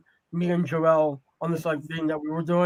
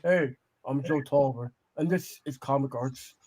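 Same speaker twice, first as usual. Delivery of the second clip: a lower, softer host greeting in someone else's voice; Joe Tolliver impression; 5 semitones lower, 5 dB quieter than usual. Friend performs an impression of the absent host, slightly lower. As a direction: play the cool leather-jacket guy opening his show. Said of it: "Pretend this is a leather jacket".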